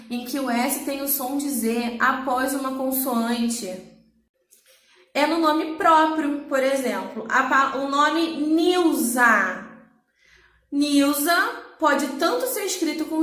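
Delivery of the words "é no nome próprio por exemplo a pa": ordinary talk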